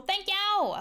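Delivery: high squealed thank you; excited thanks. Real, not acted. Thanking a viewer for compliment.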